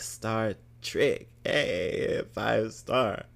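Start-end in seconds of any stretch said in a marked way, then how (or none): none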